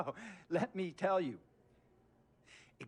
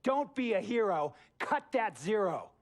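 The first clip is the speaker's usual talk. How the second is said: higher and louder, driving it home; emphatic slogan; 6 semitones higher, 3 dB louder than usual. Acted. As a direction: hammer the slogan at him. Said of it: "This guy used to tell me".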